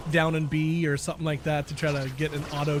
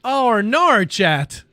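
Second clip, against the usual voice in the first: excited; 8 semitones higher, 6 dB louder, much slower than usual. Real, not acted. Exclaiming to chat.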